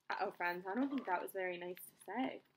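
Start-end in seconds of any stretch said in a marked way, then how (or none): none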